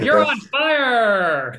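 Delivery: slow booming video game announcer; NBA Jam announcer. Retold or imitated. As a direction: bellow it like an arcade sports announcer hyping a hot streak. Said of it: "it's like N B A Jam".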